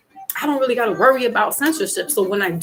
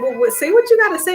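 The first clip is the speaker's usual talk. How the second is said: sassy retort; 10 semitones higher, 6 dB louder, faster than usual. Retold or imitated. Retold text quarrel, daughter.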